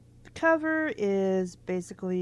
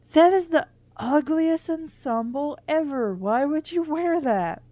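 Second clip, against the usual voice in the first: higher and louder, scoffing at the outfit; incredulous mock outrage; mocking disbelief; 6 semitones higher, 4 dB louder than usual. Real, not acted. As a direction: jeer at the clothes like a snarky friend.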